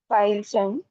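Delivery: normal talking voice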